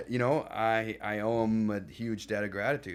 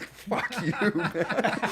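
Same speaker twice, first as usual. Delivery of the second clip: higher, laughing mock insult; laughing retort; 12 semitones higher, 5 dB louder, much slower than usual. Laughing back at Adam's joke, higher pitched. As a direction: laugh it out at your buddy. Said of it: "and I'm like".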